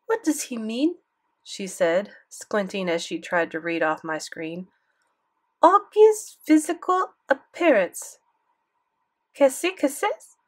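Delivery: squint and ask in French; French grandmother, puzzled; French accent. Acted. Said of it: "thick French accent".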